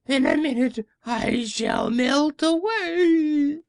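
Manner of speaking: high, slow terrified witch voice; a witch feels herself dissolving; high drawn-out witch cry of dread; screaming witch. Acted. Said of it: "she screamed".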